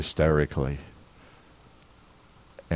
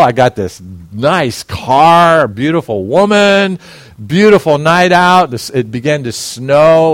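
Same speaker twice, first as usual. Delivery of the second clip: loud, high, gushing about the perfect night; elated shout; 6 semitones higher, 8 dB louder than usual. Retold or imitated. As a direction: preach it like the happiest man alive. Preacher relives perfect birthday night.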